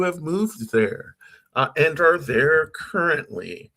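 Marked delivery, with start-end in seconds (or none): none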